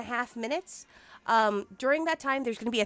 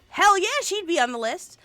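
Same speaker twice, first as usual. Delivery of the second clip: loud excited "Hell yeah, she'd be on the list"; 8 semitones higher, 7 dB louder than usual. Real, not acted. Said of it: "excited repeat of the line".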